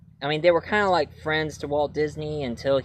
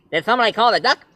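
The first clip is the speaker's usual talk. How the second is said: higher, louder cartoon duck; a lightly voiced cartoon duck; Daffy Duck voice; 7 semitones higher, 4 dB louder, much faster than usual. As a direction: squawk it like Daffy. Daffy intro line.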